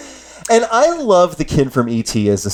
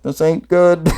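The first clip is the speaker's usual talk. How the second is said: amused, laughing worry; laughing doubt; 3 semitones higher, slower than usual. Retold or imitated. Recalling the monologue, a laughing mock worry. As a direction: chuckle it out in mock dread. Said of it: "I was like".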